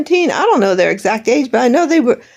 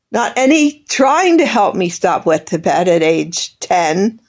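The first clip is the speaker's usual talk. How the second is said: ordinary delivery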